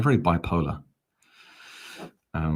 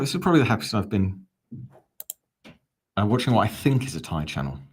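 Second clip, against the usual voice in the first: ordinary talk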